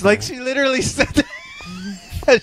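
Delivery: high, slow laughing yell; laughing shout. Real, not acted. Laughing to friends about the lady in the theatre. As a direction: crack up as you say it.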